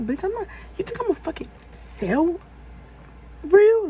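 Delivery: high-pitched indignant outburst; retold outraged comeback; going off. Storyteller replays snapping back at the caller, high and indignant. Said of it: "So I'm going off".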